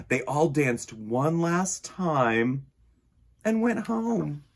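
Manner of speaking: usual speaking voice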